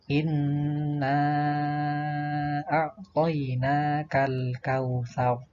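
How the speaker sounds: slow, sustained recitation with long held vowels; Qur'an recitation. Shown as a demonstration. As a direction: recite it slowly as a model for pupils. Contoh bacaan ayat. (tilawah pelan dengan bacaan panjang)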